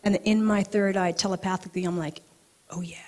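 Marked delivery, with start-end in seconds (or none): 2.7-3.0 soft, secretive reply with a laugh; quiet, amused assent; hushed agreement. Retold or imitated. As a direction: murmur it as a private yes. Retold telepathic answer to vision.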